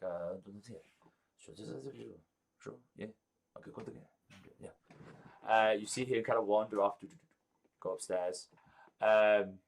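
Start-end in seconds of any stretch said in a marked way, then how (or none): 0.0-4.7 hushed aside. Acted. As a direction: mutter it softly, half to yourself. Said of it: "and he's like".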